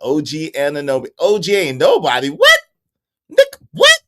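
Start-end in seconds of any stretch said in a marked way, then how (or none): 2.3-4.0 much higher and louder squeal of disbelief; shocked squeal. Real, not acted. Streamer reacting to a wild chat comment, much higher yelp.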